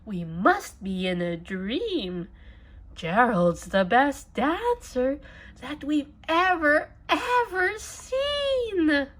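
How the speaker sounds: high, loud astonished cheer; amazed shout. A narrator's reading. Animals cheering Gerald's dancing, a high loud amazed shout. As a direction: shout it like a miracle happened. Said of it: "They shouted".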